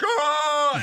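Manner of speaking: loud, high mock gasp; shocked gasp. The crowd reacting, loud shocked gasp. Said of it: "everyone's like".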